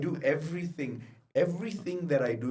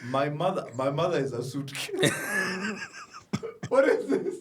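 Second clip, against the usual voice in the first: laughing, louder punchline; 4 semitones higher, 4 dB louder than usual. Real, not acted. Roasting his mom's height with his friend, laughing hard. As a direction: crack up at your own joke.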